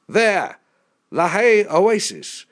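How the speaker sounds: high, loud exclamation; excited shout over engine noise; calling out. A narrator's reading. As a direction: point out a sight with a shout. Pilot calls out, pointing ahead.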